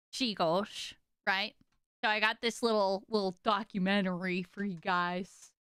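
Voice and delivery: ordinary talk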